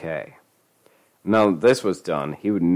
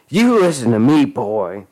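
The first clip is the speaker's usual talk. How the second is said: loud furious threat; 4 semitones higher, 6 dB louder than usual. Acted. Furious grab.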